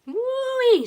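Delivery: high, loud, drawn-out exclamation; shocked woo-wee. A narrator's reading. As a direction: hoot in shock at the mess. Sister shocked by haircut.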